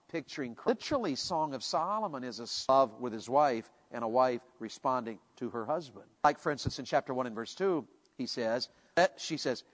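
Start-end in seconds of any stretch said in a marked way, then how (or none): none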